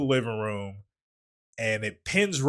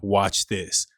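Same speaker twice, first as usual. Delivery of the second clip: the movie talking back; 6 semitones lower than usual. Acted. Movie warns the viewer of more horror, low taunt. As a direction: drop the voice for an ominous promise. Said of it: "it said".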